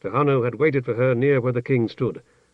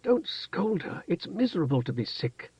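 gentle protest; 5 semitones higher, 6 dB quieter than usual. A narrator's reading. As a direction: plead softly for the princess. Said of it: "Tehanu protested".

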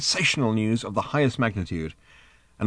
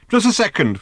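high, raised call back; shouting; 11 semitones higher, 5 dB louder than usual. Acted. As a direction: shout it back to put him off. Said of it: "Ford shouted".

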